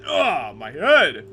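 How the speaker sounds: loud, slow wail of pain; hammed-up pain. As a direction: cry out, drawing out each word. [name] hits head falling.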